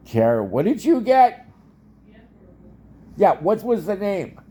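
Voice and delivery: loud, high call across the house. Real, not acted. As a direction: call out to someone in another room. Calling out to Kara in another room.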